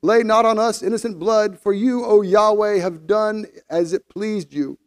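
high, pleading cry to God; calling out in prayer. A narrator's reading. Sailors call out to Yahweh for their lives, pleading prayer. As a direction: plead aloud for mercy. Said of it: "they called out to Yahweh".

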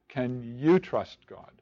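slow question with "you" stretched and stressed; "you" stands out, ending on a falling "God". Shown as a demonstration. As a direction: press on "you", let "trust God" fall. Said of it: "the emphasis on the word you".

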